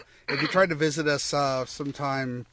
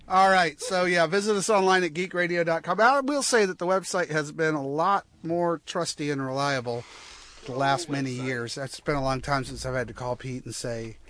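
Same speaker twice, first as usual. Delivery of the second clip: ordinary talk, nothing marked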